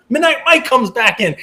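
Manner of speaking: high excited race call; race caller. Acted. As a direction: call the stretch run. Calling bids like horse race.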